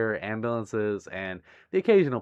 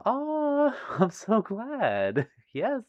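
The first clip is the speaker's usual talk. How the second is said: high, drawn-out happy coo; real delight, stretched out; touched delight; 11 semitones higher, much slower than usual. Real, not acted. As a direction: gush with gladness, slow and high. Delighted by viewer's thanks.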